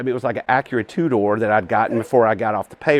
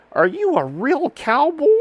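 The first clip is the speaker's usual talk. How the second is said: put-on high, slow little boy asking in wonder; a small kid's awed question; 13 semitones higher than usual. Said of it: "this little boy goes".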